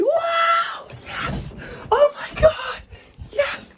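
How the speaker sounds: very high falsetto cries of joy; acted-out ecstatic squealing; euphoric. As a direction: whoop like someone running on pure elation. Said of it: "I was fucking euphoric".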